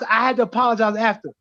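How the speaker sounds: ordinary talk, nothing marked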